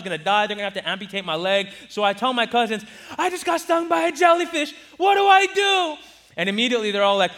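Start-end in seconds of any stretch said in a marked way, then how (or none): 3.2-6.0 put-on high, loud, panicky little-boy cry; panicked kid. Stung boy begging his cousins for help, panicked. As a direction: blurt it out in a childish panic.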